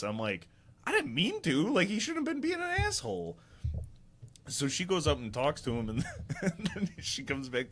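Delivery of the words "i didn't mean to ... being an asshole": very high, whiny self-defence; hammed-up pleading innocence; retold defensive protest. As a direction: act out a squeaky, indignant excuse. Protesting to angry mom, retold.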